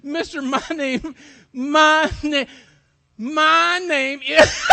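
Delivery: acted helpless laughing speech; laughing too hard. A narrator's reading. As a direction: try to get the words out through giggles. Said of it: "she was laughing so hard".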